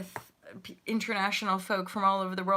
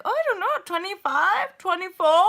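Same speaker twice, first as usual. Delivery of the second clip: much higher, lightly voiced girls; sweet guessing girls; 11 semitones higher, 7 dB louder than usual. Girls guessing her age.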